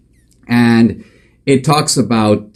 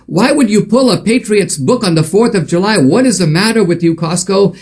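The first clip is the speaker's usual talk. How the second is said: much higher, indignant voice of outraged customers; mock outrage; 9 semitones higher than usual. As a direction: huff like a fed-up shopper. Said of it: "basically saying".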